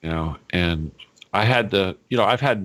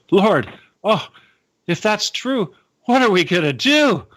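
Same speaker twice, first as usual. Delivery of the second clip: high-pitched mock dismay; theatrical wailing alarm; dismayed disciples; 10 semitones higher, 3 dB louder than usual. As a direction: plead helplessly, hammed up.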